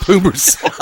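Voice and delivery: light laughing Jim Ross call. Retold or imitated. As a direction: toss off the catchphrase like the announcer, giggling. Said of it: "thank you, Jim Ross".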